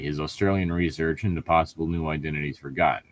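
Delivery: ordinary talk, nothing marked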